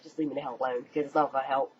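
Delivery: usual speaking voice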